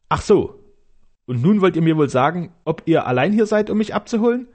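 put-on a light, amused young lady asking; curious lady. Amy questions him.

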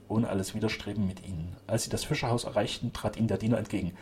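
plain reading voice

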